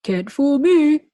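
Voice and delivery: the principal pleased with himself; smug principal. Acted. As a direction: crow it proudly. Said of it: "he said".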